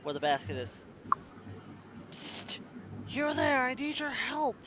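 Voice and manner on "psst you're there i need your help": high urgent whisper-shout; whisper yelling. Acted. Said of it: "The whisper sounds like a whispered yell".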